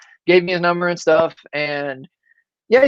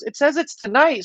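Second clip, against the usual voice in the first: put-on 1 chirpy fan girl; 8 semitones higher than usual. Girl reads her badge.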